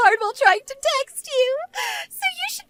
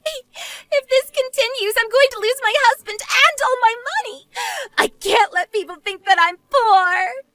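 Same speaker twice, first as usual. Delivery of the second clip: mildly desperate, acted plea; a touch of panic; panicked protest; 5 dB louder than usual. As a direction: plead as everything slips away. Eleanor panics about losing money.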